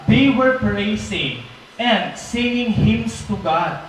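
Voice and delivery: very slow, drawn-out preaching line; slow, reverent sermon delivery; preaching emphasis. Acted. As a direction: linger on every word for awe. Dramatic sermon retelling.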